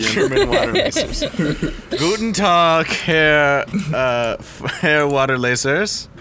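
loud, hammed-up German accent; playful fake German, laughing at first; mock German accent. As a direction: bark it in a joke German accent. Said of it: "That's German".

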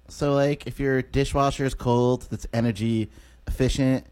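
awkward unpolished comedian. Retold or imitated. Comedian mocking his own set beside polished visitors, awkward low monotone. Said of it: "And then I'm all just like".

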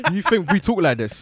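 laughing, high mocking fake British voice; mock American-British accent. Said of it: "felt like they were taking the piss".

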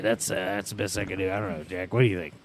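ordinary talk, nothing marked

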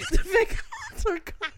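high, loud imitation breaking into laughter. Train stranger leads a chant, loud laughing imitation. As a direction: chant his warning while cracking up. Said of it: "he gets louder now".

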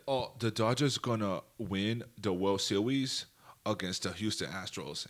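slow, low, badly faked African accent; mock African accent. Retelling Joel Embiid's prediction in a badly faked African accent. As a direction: fake a clumsy African accent, drawing the words out. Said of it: "That was the worst African accent ever".

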